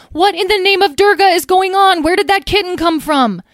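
high, loud outraged demand; shrieked demand for an explanation; yelling. A narrator's reading. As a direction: shout an exasperated question. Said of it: "yelled Talora".

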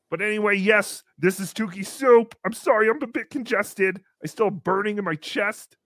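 ordinary talk

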